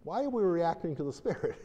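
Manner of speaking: high, incredulous question; exasperated question. Retold or imitated. Minister stopping his team mid-deliverance, high incredulous question. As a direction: catch yourself and question the whole room. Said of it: "but I said".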